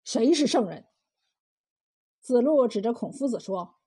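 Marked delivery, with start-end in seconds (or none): 0.0-0.9 stern sharp question. A narrator's reading. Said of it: "老渔民厉声问".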